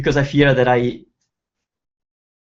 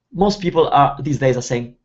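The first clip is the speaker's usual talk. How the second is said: normal talking voice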